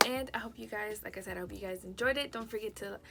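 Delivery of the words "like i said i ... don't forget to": normal talking voice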